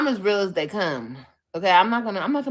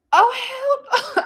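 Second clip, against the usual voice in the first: high, loud burst of shock; shocked reaction; 6 dB louder than usual. Retold or imitated. Retelling her own reaction to the aids accusation, shocked. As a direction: yelp it out like you can't believe it.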